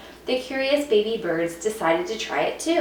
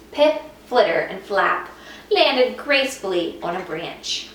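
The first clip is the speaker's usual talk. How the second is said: plain reading voice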